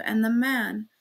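ordinary delivery

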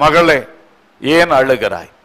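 low, hushed, fatherly question; tender comforting. Acted. The Lord asking a weeping daughter, tender comforting. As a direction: drop low and gently console.

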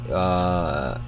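usual speaking voice

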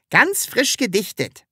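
high-pitched proud little boast; pleased with one's own work. A narrator's reading. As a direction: announce it proudly, pitched well up. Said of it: "antwortete das Sams stolz".

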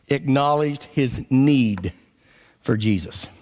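ordinary talk

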